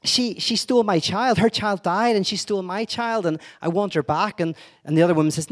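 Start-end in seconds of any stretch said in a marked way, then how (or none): 0.0-4.4 raised, pleading woman's voice; a retold mother's plea. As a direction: cry out that your child was stolen. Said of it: "one of the women says".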